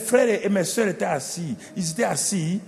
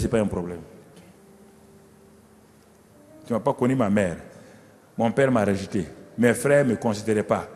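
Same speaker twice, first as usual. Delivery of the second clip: put-on old David, tender; 6 semitones lower than usual. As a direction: hush it like a dying king confiding.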